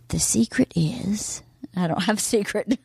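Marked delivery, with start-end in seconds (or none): 0.1-1.4 slow, suspenseful whisper; mock secretive whisper. Wife pretending to reveal a shocking secret, mock whisper. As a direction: whisper it slowly as if revealing something huge.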